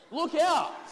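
high, loud heads-up; calling out. A narrator's reading. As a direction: call it out to the whole street. Man warns of someone coming.

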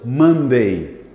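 Monday very slow, man-day; slow model. Shown as a demonstration. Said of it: "É man". (Monday bem devagar como man-day)

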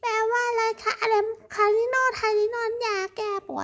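high, cutesy pet voice asking the question; cat's voice. Pretend. Owner voices her cat asking.

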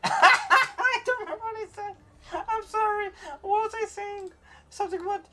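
very high squeaky voice with laughter; laughing falsetto. Real, not acted. Streamer forgot what was said, laughing falsetto.

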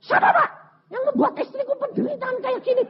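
high, loud mock-furious demand; acted anger. Husband demands to know who hurt his wife, acted anger. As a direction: play the enraged husband, voice shooting up. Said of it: "marah, marah, mukanya merah".

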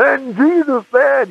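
high, drawn-out preacher cry; old-fashioned preacher. Retold or imitated. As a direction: mimic a fervent old-time preacher, stretching the words. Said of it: "preached like an old-fashioned preacher".

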